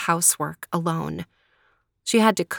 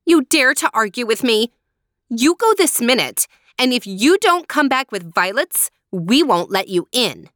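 higher, louder furious threat; angry shout; 5 semitones higher, 4 dB louder than usual. Acted. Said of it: "Helena shouted".

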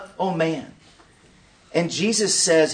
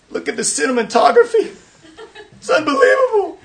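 mock gushing viewer; 12 semitones higher, 5 dB louder than usual. Mock emotional movie viewer.

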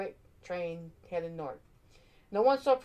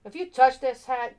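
much louder, stern Santa warning; 6 semitones higher, 9 dB louder than usual. A narrator's reading. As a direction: warn the thief firmly. Santa warns Hinkle.

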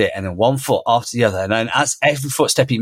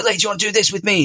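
excited invitation; 9 semitones higher, faster than usual. Retold or imitated. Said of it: "you put in this really positive, excited energy".

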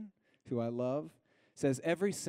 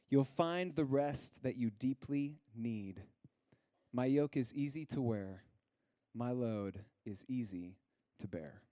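scripture reading. Acted. Pastor to congregation, gently reading scripture aloud. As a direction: read it like a gentle invitation.

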